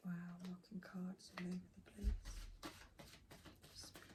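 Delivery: quick hushed unvoiced whisper, fading out. Real, not acted. Reader whispering while cards fly out. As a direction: whisper fast to yourself.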